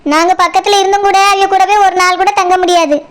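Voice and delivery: ordinary talk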